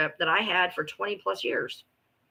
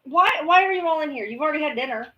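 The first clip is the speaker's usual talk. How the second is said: ordinary talk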